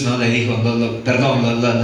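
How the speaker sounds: usual speaking voice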